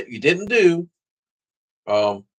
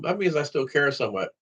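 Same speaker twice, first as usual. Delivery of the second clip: ordinary talk, nothing marked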